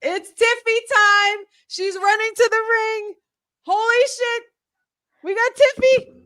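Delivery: thrilled fan shouting; excited shout. Real, not acted. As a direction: hype up her entrance at full volume. Live reaction to Tiffy running in.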